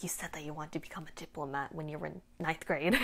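softly mimicked official, dropped level; mock follow-up. Imagining scholarship staff tracking down a student later, soft mock follow-up. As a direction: quote it under your breath.

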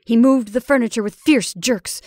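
usual speaking voice

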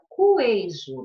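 queijo said as ku-EI-jo, with the U sounded; mispronounced queijo with an audible U after the Q; U wrongly pronounced. Shown as a demonstration. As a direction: voice the U in queijo: kwei-jo. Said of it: "eu não pronuncio o U".

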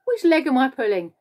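high, loud, gleeful question; gleeful taunt. A narrator's reading. Centipede pointing at the earthworm, gleefully taunting. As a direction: tease with great glee. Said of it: "wriggling with glee and pointing at the earthworm".

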